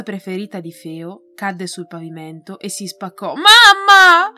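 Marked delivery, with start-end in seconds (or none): 3.3-4.3 put-on girl's loud, high call to her mother; cry out. A narrator's reading. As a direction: shout Mamma in alarm, much higher and louder. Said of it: "esclamò Feo".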